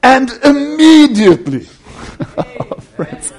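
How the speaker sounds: normal talking voice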